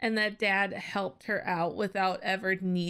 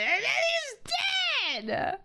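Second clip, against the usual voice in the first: higher, breaking voice on a sob; crying. Real, not acted. Viewer repeating the death in disbelief, crying. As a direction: say it again as the tears come.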